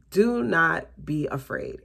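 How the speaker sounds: usual speaking voice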